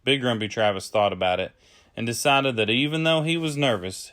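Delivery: usual speaking voice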